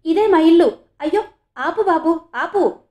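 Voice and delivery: high, urgent yell; loud excited shout. A narrator's reading. Janaki spots her house and stops the driver, loud shout. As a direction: shout to stop, bursting with excitement. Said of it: "గట్టిగా అరిచింది".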